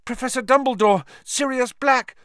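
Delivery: urgent outburst. Acted. Harry bursting out urgently.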